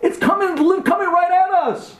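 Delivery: high-pitched, urgent alarm; frantic high cry; panicked cry. Acted. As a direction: stumble out a high warning in terror. Acts terrified family, danger approaching.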